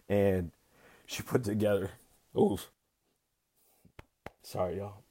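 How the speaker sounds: real emotion halting the words; choked up. Real, not acted. Expectant father moved by sister-in-law's kindness, choked up. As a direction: break off with an exhale, then a quiet sorry. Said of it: "I'm getting a little emotional".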